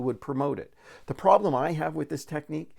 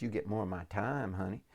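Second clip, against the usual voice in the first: put-on Texas-Louisiana accent; 5 semitones lower, 10 dB quieter than usual. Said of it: "think about what that accent sounds like".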